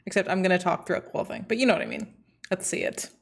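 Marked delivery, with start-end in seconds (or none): 2.5-3.1 hushed whisper before the video; soft unvoiced hush. Real, not acted. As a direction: whisper it, barely voiced. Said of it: "I'm ready".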